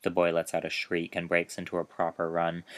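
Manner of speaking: ordinary delivery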